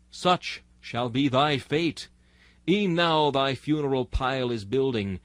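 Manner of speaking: ordinary talk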